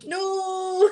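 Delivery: high, drawn-out "no" through laughter; laughing. Real, not acted. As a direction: wail it helplessly while laughing. Laughing hard while reading comments.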